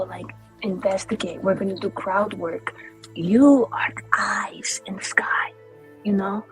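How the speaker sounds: ordinary talk, nothing marked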